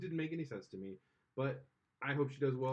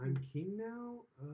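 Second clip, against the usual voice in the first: lightly voiced, higher and slow, unsure new king; a hint of a timid actor playing a king; Michael Cera as T'Challa; 8 semitones higher than usual. Mocking a miscast king to her cohost, higher and unsure. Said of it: "mimic Michael Cera trying to do an African accent".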